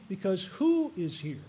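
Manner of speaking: slow, stressed "who" in a drawn-out question; emphatic question. Real, not acted. Preacher asking the congregation, slow stressed "who". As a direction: lean on "who" and let it hang.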